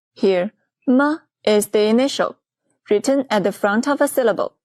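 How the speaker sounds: normal talking voice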